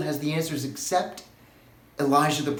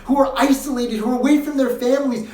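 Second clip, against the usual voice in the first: higher, pleading questions of grief; anguished questioning; 8 semitones higher, 4 dB louder than usual. Real, not acted. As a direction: cry out the questions in pained disbelief. Lament over tragic deaths.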